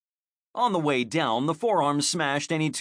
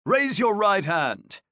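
raised voice; 7 semitones higher, 3 dB louder than usual. A narrator's reading. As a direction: call it out firmly to one man. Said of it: "Alario requested".

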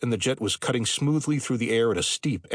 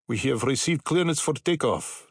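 put-on Middle European accent; 4 semitones higher than usual. A narrator's reading. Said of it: "His accent was Middle European, maybe Czech or Hungarian".

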